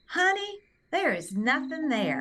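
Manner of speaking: Southern lilt, high swoop on "Honey"; Southern accent. Retold or imitated. Technician tells the patient there's nothing there, in a Southern accent. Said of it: "the technician in her little Southern accent".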